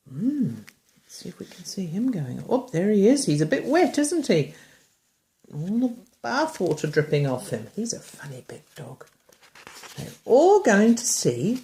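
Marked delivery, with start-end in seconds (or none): none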